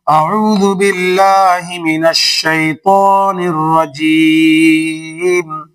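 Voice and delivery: slow chanted tajweed recitation; leading children in recitation; Quran recitation. Shown as a demonstration. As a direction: recite slowly and melodically. Recitation drill.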